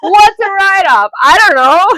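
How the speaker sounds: high, loud, slow mock-clueless shout; excited mock question. Joking about write-off.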